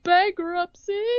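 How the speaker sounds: high, slow disbelieving question; incredulous. Real, not acted. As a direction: echo it back in disbelief. Incredulous echo of the answer.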